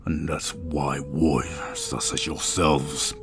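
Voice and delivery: deep, slow, whispery stone voice; talking statue. Acted. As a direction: drag each word out like a god in stone. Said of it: "In a deep voice that almost seems to permeate".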